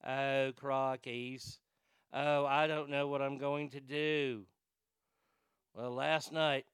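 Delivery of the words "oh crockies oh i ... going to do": slow, drawn-out lightly done Australian twang; a hint of a dim Australian twin, dragged out; mock Australian twin. Mocking the Australian twins on the show, slow Australian drawl. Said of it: "And they talk like this".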